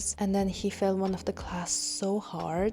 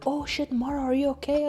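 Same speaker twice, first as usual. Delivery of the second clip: higher, startled call; alarmed; 6 semitones higher, 3 dB louder, faster than usual. Retold or imitated. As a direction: rush the worry out, friends alarmed. Friends alarmed after the slap.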